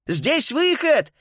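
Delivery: high, drawn-out excited call. A narrator's reading. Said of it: "крикнул он".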